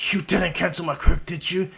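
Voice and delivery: acted indignant challenge; angry confrontation. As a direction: demand it in mock outrage. Bakugo angrily confronts Izuku.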